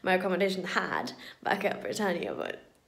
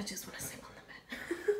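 laughing tired whisper; 12 dB quieter than usual. Real, not acted. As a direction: breathe it out with a laugh. Tired aside, breathy laugh.

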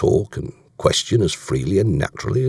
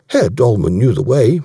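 stubborn, louder and higher; insistent reply, pitched up; dogged; 5 semitones higher, 6 dB louder than usual. A narrator's reading. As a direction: hold your ground doggedly. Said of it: "said Davies doggedly".

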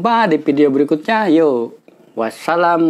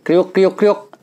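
crunching chips; 3 semitones higher, slower than usual. Acted.

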